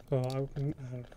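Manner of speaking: ordinary delivery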